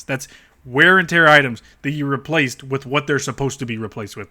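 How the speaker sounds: normal talking voice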